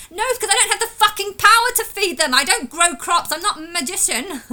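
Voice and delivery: high, loud furious retort; angry outburst. Real, not acted. Retorting to people who blame him, loud angry shout. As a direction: shout back the answer.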